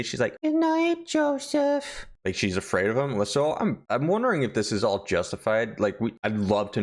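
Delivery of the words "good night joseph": put-on much higher, timid little girl; small frightened girl voice, much higher; timid child. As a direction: say it like a scared child to her stepfather. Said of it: "she gives it very timidly".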